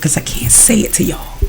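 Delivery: very soft, almost voiceless aside; hushed confession. Real, not acted. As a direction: trail off into a near-whisper. Confiding what cannot be said.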